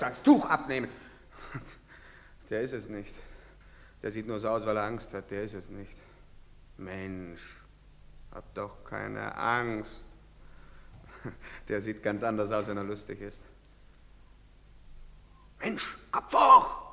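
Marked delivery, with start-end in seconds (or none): 2.5-13.4 low, subdued murmur; hushed aside. Acted. Onlooker about the frightened suspect, hushed aside. 15.6-16.9 high, loud, drawn-out call; shouted urging. Acted. Official to a frightened man, shouted urging.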